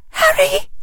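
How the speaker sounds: high-pitched gasping cry; gasped. A narrator's reading. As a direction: gasp it out in alarm, very high. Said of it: "she gasped".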